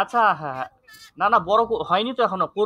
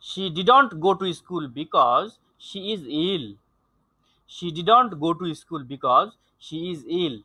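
very slow, measured reading in short phrases; teacher's slow demonstration reading; model reading; 2 semitones lower, much slower than usual. Shown as a demonstration. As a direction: read slowly so students can follow. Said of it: "I am reading and you have to follow".

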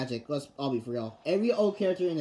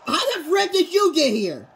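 loud and slow, shocked; shouting; 13 semitones higher, 10 dB louder, slower than usual. Real, not acted. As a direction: yell it out in disbelief. Shocked outburst at the character.

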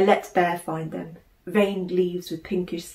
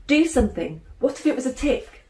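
indignant; 6 semitones higher than usual. A narrator's reading.

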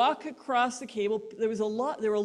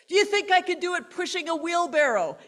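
loud, high challenge to the crowd; showman's call; 7 semitones higher, 5 dB louder than usual. Retold or imitated. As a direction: egg the crowd on.

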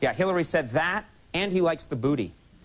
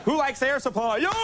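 high-pitched shout-out to a crowd; hyped-up crowd-baiting yell; mock crowd rallying; 11 semitones higher than usual. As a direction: call out to rile up the audience.

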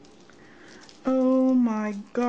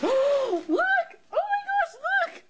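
squeal, overwhelmed with excitement; freaking out; 12 semitones higher than usual. Real, not acted. Crafter finding another surprise in the box, freaking out. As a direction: gasp into very high squeals, settling slightly. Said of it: "I'm freaking out".

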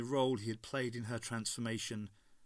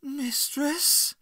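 very high, hurt question; high, emotional rising appeal; full of emotion; 13 semitones higher, 6 dB louder than usual. A narrator's reading. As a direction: ask it rising, wounded and disbelieving. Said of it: "a wealth of emotion in that one word".

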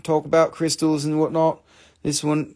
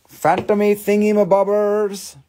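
Phantomy thingy-mabobbers sung out higher and louder; higher, louder playful Phantomy thingy-mabobbers; playful sing-song; 7 semitones higher, 5 dB louder than usual. Shown as a demonstration. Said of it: "repeat higher, playful".

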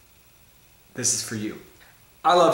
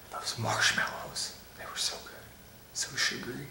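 hushed, dreamy whisper over the marshmallows; 14 dB quieter than usual. Acted. Reviewer confides to viewers about marshmallows, whispering. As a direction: breathe it like a guilty secret.

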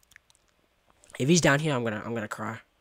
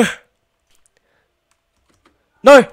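usual speaking voice

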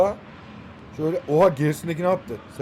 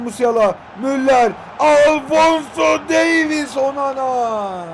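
drawn-out commentary shout; 5 semitones higher, 4 dB louder, much slower than usual. Real, not acted. Calling passes as live commentary.

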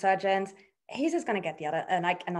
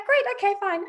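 high, loud, offhand okay; imitating the boss, high and breezy; breezy mock okay; 12 semitones higher, 4 dB louder than usual. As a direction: brush it off brightly, high and loud. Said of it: "she was like".

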